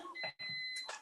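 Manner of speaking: very high, soft squeaky giggle; a tiny squeaky laugh. Real, not acted. Laughing at the chat, high squeaky giggle.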